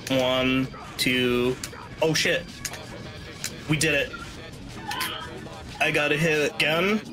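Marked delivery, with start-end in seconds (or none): none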